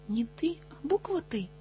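high-pitched, worked-up correction; exasperated correcting. Acted. Tutor argues over a letter with a pupil, exasperated.

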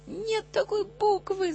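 high, slow exasperated cry; shouting. A narrator's reading. Tutor cries out at a muddled pupil, exasperated shout. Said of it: "закричал я".

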